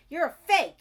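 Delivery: high, very loud yell. A narrator's reading. Bertie yelling at the caller, temper snapped. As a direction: scream it in a temper. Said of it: "She yelled".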